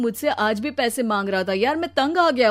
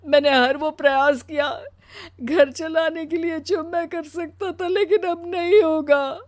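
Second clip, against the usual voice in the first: crying voice, pitched up, growing louder; tearful husband; 6 semitones higher, 5 dB louder than usual. Acted. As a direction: pour out the humiliation through acted tears. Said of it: "वो रोने लगता है". (रोते हुए ऊँची, दुखी आवाज़)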